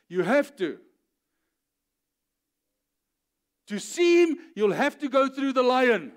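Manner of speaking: loud, high, emphatic sermon delivery; forceful preaching. Real, not acted. Preacher insisting you must go through the lion, forceful. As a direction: drive each point home at full force.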